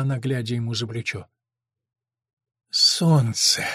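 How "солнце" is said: slightly hoarse answer; understated hoarse reply. A narrator's reading. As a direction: say it with a touch of huskiness. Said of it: "хрипло проговорил Дэн".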